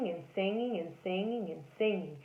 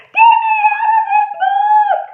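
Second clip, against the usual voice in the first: very high, loud shriek; terrified scream; 16 dB louder than usual. Acted. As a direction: scream in panic to escape. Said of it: "and I screamed".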